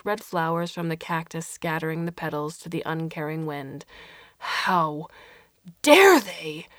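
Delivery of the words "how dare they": loud outraged cry; angry. Acted. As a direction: spit out the outrage. Alberto furious at invasion.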